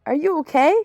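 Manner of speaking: calling out. A narrator's reading. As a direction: call it loudly, lifting at the end.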